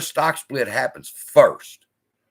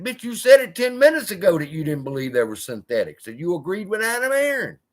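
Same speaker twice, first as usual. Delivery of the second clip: higher, scoffing callout; scornful retort; 7 semitones higher than usual. Acted. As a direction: call him out with mocking disbelief, pitched up. Retort to Tony's denial.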